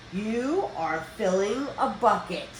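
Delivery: usual speaking voice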